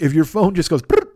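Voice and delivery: usual speaking voice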